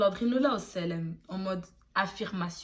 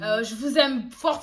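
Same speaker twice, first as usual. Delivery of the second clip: ordinary delivery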